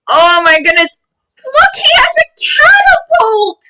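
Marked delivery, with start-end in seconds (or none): 1.4-3.6 high thrilled shout; excited. Real, not acted. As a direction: shout it with delight.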